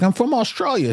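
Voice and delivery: put-on a clumsy, high-pitched stab at an Australian accent; badly done Australian accent, half laughing at yourself; Australian accent attempt. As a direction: try her Australian accent and miss it a bit. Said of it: "don't let me try to do these accents".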